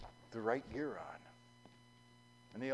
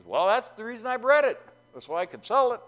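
raised, confident voice; cocky farmer; 11 semitones higher, 11 dB louder than usual. Acted. John brushing off the pastor's question, raised voice. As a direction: brush him off breezily.

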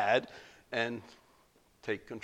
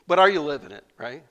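1 emphatic challenge; 4 semitones higher, 8 dB louder than usual. Real, not acted. Preacher challenging the congregation.

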